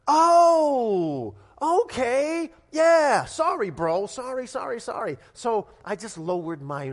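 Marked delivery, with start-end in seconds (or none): 0.1-5.2 loud, high, flustered sorry, fading down; sheepish apology. Retold or imitated. Young man caught cursing apologizes to a father, flustered. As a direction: blurt the apologies, then trail off.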